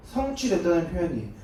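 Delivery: ordinary talk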